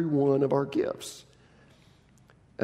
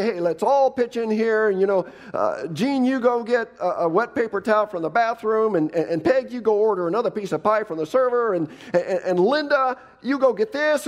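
higher, louder take-charge voice handing out orders; bossy organizer; 9 semitones higher, 4 dB louder than usual. Acted. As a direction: play the one who runs the table. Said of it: "those of you who would say".

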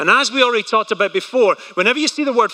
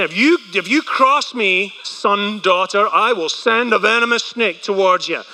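ordinary talk, nothing marked

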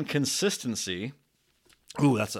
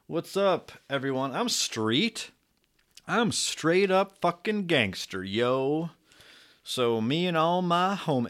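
higher, slowed-down fake street swagger; mock gangster voice; 5 semitones higher, slower than usual. YouTuber greeting viewers as a fake gangster, hammed-up. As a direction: play a wannabe gangster, hamming it up. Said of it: "am I racially appropriating?".

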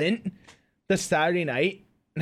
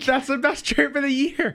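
laughing; 7 semitones higher than usual. Real, not acted. Repeating the joke, laughing.

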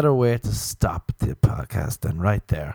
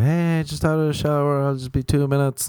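high woman voice; 7 semitones higher than usual. Retold or imitated. Roommate calling from the shower.

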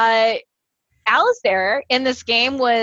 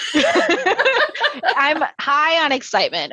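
said through laughter; laughing; 5 semitones higher than usual. Real, not acted. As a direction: laugh while protesting. Laughing defense.

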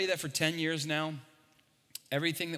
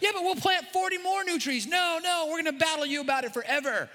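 much higher, squeaky bickering voices; mock argument voices; 11 semitones higher than usual. Pastor acts church versus city argument, squeaky. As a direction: ham up the back-and-forth.

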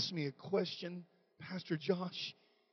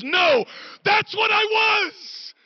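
high, loud, slow declaration; shouted quote; 18 dB louder, much slower than usual. Retold or imitated. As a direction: belt it out as Annie, firm and proud. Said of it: "She goes".

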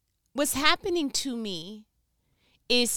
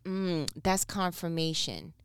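mock smug; 4 semitones lower, 6 dB quieter than usual. Mocking someone's self-deception.

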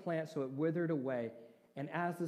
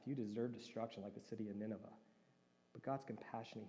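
ordinary delivery

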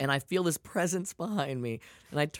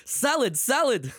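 higher, louder mock yell of "Salad, salad"; imitated yelling; 10 semitones higher, 4 dB louder, slower than usual. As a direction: shout it at a stranger in the store. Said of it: "Why are you yelling salad at me?".

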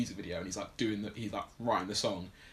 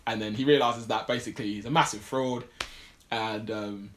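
ordinary talk, nothing marked

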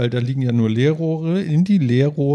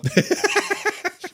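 high laugh; 3 dB quieter than usual. Real, not acted. Laughs at failed unison.

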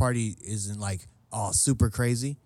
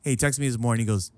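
usual speaking voice

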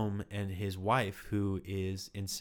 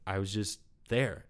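ordinary talk, nothing marked

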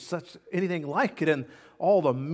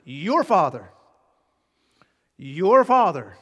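Your Father, louder each time and slowing; emphatic announcement; 9 dB louder than usual. Shown as a demonstration. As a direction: proclaim "Your Father" with rising force. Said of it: "that's an emphatic announcement".

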